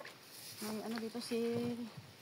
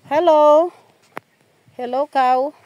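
loud, raised call to a cow; 5 semitones higher, 12 dB louder, slower than usual. Real, not acted. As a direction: holler a greeting across the field. Calling out to a cow. (malakas na pagtawag)

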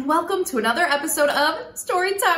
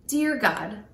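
put-on children's prayer; 5 semitones lower than usual.

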